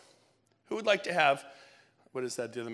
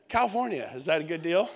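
ordinary talk, nothing marked

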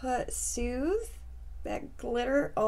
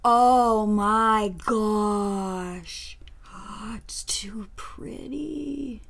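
awed whisper; 5 dB louder, much slower than usual. Real, not acted. Awestruck at the finished eye.

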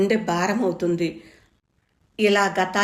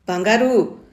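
raised voice, rising high at the end; calling out; 6 semitones higher than usual. A narrator's reading. Mother calling the girl in from the rain. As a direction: call her in urgently from the door. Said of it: "అని కేకవేశాను".

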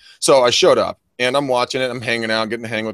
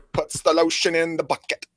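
movie villain; 5 semitones higher than usual. Acted. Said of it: "okay, Hannibal Lecter".

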